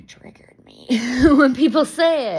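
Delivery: laughing whisper. Real, not acted. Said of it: "laughing whisper".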